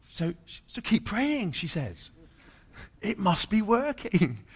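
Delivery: beaming over his recovery; excited wife. Retold or imitated.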